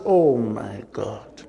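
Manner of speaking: low, slow, stunned realisation; stunned oh my God. Retold or imitated. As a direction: let the shock sink in slowly. Shocked at the roadhouse meaning.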